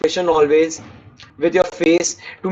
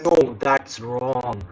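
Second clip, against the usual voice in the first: slow, lower, firm no; firm correction; 7 semitones lower, 5 dB quieter, much slower than usual. Shown as a demonstration. Teacher showing students a firm disagreeing no. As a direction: shut the idea down slowly. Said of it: "So add emphasis like you are saying".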